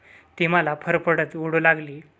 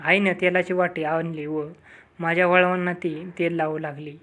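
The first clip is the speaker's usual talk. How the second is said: ordinary delivery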